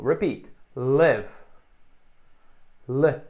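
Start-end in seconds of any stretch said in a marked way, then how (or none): 0.8-1.3 short i in "Live". Shown as a demonstration. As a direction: clip the vowel in "Live". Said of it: "na esquerda é curto".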